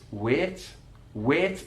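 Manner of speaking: wait with a pure flat eh vowel; Mackem wait. Shown as a demonstration. Teacher showing the Mackem flat vowel in wait. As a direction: flatten the vowel of wait to one steady eh, Mackem style. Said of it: "monophthong singular vowel sound".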